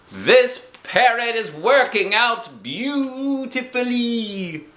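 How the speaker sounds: high, slow singing-out; calling out. A narrator's reading. Girl calls from the pool, calling out. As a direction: draw each word out high across water. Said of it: "I called again".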